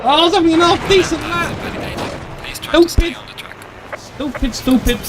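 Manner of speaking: high-pitched exasperated cry; frustrated outburst. Real, not acted. Ruined a half decent lap.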